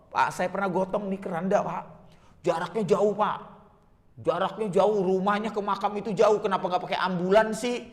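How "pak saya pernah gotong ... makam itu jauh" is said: ordinary talk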